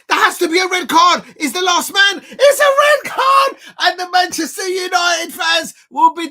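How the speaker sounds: high, loud yelling for a red card; furious excited shout. Real, not acted. Fan screaming at the screen for a red card, loud and high. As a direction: scream it like the ref must hear you. Fan erupts at a last-man foul.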